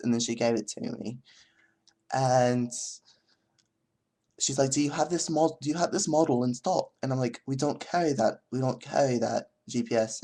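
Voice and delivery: mock British storytelling voice; bad British accent. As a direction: spin an anecdote in a cheesy British accent. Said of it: "a really bad British accent like this".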